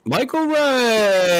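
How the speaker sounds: high, slow, stretched name call; announcer-style name call. Acted. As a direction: announce him like a game show host.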